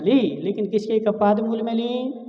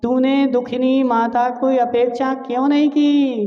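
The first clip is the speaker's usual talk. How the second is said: wailing mother's lament; 4 semitones higher than usual. Acted. Mandodari reproaching her sons for leaving, voiced lament. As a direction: cry out to the sons like a grieving mother. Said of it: "रोते रोते क्या बोल रही है".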